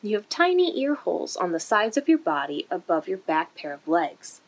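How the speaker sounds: ordinary delivery, nothing marked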